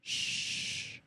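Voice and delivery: long shh, then whispered hush; imitated shushing. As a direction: mimic a voice whispering for silence. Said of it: "he also went".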